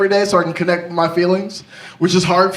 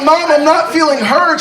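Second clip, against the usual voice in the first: higher, louder, slow whine; mock petulant whine; whiny plea; 6 semitones higher, 4 dB louder than usual. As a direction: plead like a hurt teen.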